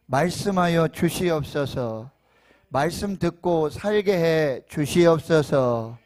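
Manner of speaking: loud building prayer to God; fervent prayer. Real, not acted. Opening prayer.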